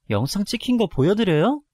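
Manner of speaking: loud, high defiant challenge; angry challenge. Acted. Daughter-in-law threatening to show the video, defiant. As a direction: dare him to see the proof. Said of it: "너무 화가 나서 시아버지에게 바로 달려가 따졌습니다".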